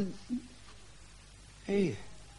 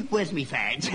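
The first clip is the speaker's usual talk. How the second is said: ordinary talk, nothing marked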